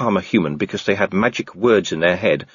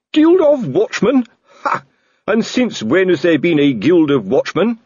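much higher, loud scoffing disbelief; scornful outburst; 10 semitones higher, 4 dB louder than usual. Acted. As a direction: sneer at the idea of a guild. Colon scoffing in quarrel.

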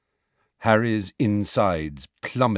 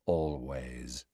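low, hushed single word; soft echo; 8 semitones lower, 11 dB quieter than usual. A narrator's reading. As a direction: breathe it back softly. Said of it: "echoed Malfoy softly".

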